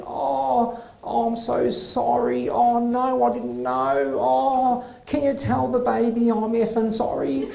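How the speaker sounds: mock-sweet sorry, gushing; sudden apology. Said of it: "all of a sudden she's all apologetic".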